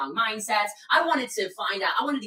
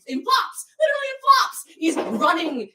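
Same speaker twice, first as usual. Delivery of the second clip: high, loud incredulous telling; excited amazement; 10 semitones higher, 7 dB louder than usual. Real, not acted. Telling how guide ran in flip-flops, excited amazement. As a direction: blurt it out in amazed emphasis.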